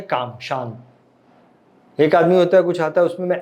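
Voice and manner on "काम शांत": very slow, low, soft and soothing; calm. Shown as a demonstration. Teacher showing the calm opposite of anger, slow soft. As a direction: breathe the word out slowly, softer and lower. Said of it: "anger का नेग-, उल्टा क्या है?".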